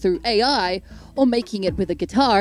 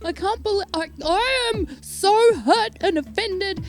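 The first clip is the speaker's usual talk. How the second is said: mock hurt fans; 7 semitones higher than usual. Mocking offended fans.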